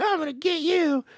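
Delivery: high-pitched taunting yell; high, playful threat; mock threat. Acted threat in retold film scene.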